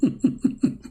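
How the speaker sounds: high, breathy giggling fit; real laughter. Real, not acted. Speaker laughs at his bathroom excuse joke, real laughter. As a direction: crack up at your own impression.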